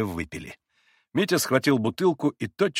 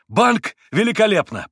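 loud, high, delighted seizing on it; eager agreement; 9 semitones higher, 7 dB louder than usual. A narrator's reading. Mitya takes up the card idea, loudly enthusiastic. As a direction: jump at the idea. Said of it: "подхватил Митя".

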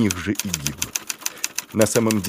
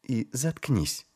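quiet command; 3 semitones higher, 7 dB quieter, slower than usual. Acted. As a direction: order him quietly to shut up. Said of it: "велел Шельма".

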